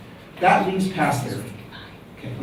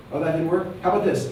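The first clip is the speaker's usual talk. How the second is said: ordinary talk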